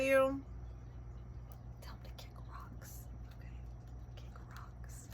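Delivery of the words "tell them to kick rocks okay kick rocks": whispered aside, much softer. Real, not acted. Reader whispering to viewers to dismiss doubters. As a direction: breathe it like a secret dismissal.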